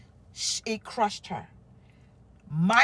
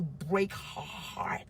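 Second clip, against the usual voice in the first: softer and slower, voice sinking low; choked-up, hushed; 5 semitones lower, 8 dB quieter, much slower than usual. Real, not acted. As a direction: let the words almost break as you say them. Painful confession of hidden truth.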